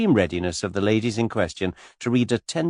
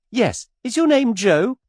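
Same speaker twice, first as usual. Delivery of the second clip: put-on much higher, girlish gushing voice; skittish young lady; 6 dB louder than usual. A narrator's reading. Miss Taylor pestering Mister Cade, high and gushing. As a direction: flutter up high like a giddy girl.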